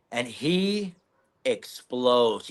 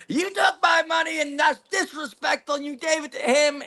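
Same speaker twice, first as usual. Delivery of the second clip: angry yell; 12 semitones higher than usual. Retold or imitated. Crackhead confronting them outside.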